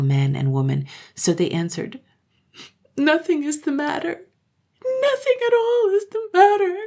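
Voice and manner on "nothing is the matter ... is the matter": very high tearful wail; acted bawling, high-pitched; crying children. A narrator's reading. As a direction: deny it through childish crying. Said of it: "and cried all the more".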